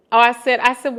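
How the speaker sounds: usual speaking voice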